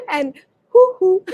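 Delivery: high hoo hoo laugh; hooting laugh. Shown as a demonstration. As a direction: hoot with laughter. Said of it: "laughing as you go".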